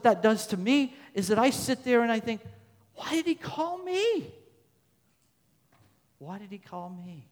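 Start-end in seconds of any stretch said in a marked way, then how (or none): 2.9-4.3 high, drawn-out incredulous question; acted astonishment. Preacher voices his own wonder. 6.2-7.3 much softer, reflective question; hushed wondering. Acted. Same question, hushed repeat.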